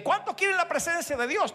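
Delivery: high, rising preacher call; mock altar call. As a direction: call out to the congregation. Pastor imitates altar call. (llamado al altar imitado, agudo)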